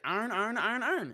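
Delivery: mock speaker saying iron over and over; high-pitched repetition. Friend retelling a video, high mock iron chant. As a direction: raise the pitch on each iron. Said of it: "he's like".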